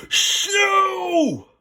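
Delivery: high, loud shout; yell. Acted. Kip yelling from the tree to scare the wolf. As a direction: yell it as loud as you can. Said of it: "Kip yelled".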